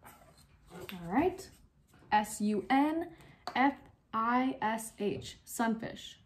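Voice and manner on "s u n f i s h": each letter slow and apart; slow, clear letter-by-letter spelling; spelled out letter by letter. Shown as a demonstration. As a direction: spell it slowly so they can check their boards. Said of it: "The word was sunfish".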